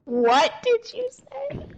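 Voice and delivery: very high, slow, incredulous; outraged disbelief. Retold or imitated. Guest confronting the manager, very high slow outrage. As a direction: stretch each word, unable to believe it.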